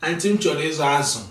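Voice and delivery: fast, casual market talk; quick market request. Shown as a demonstration. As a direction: toss it off fast to the seller. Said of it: "you will say something like this".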